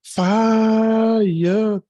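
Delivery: high, loud, drawn-out fire call; hype shout. Real, not acted. Host answers cohost's Sarasota remark with a loud, high, stretched hype call. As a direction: belt it out like a hype man.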